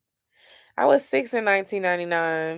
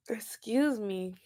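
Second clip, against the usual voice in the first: yawning; 3 semitones higher, 6 dB quieter, slower than usual. Real, not acted. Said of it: "I'm yawning all on the camera".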